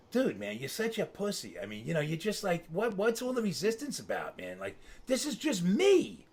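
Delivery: mock exasperation with yourself; self-reproach. Retold scolding of himself while driving.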